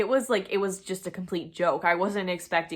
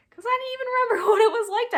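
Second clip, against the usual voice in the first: quick, high and louder with a laugh; 8 dB louder, faster than usual. Real, not acted. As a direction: giggle through the embarrassing admission. Laughing at forgotten crush feelings.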